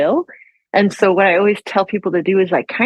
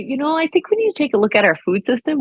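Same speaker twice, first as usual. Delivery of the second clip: polite husband; 6 semitones higher than usual. Retold or imitated. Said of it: "which is polite way of saying".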